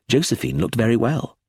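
ordinary delivery, nothing marked

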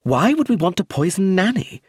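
high, loud indignant anger; angry. Acted. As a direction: throw the question back furiously. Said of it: "said Clemency angrily".

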